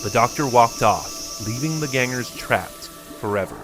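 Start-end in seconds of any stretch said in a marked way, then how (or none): none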